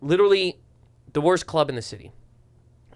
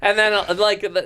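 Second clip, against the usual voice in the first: usual speaking voice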